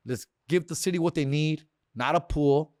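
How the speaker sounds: ordinary talk, nothing marked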